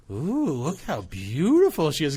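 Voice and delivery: slow, drawn-out cooing in imitation; admiring old ladies. Old ladies in the hallway admiring the new girlfriend, cooing imitation. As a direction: imitate old ladies gushing over someone. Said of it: "and they're like".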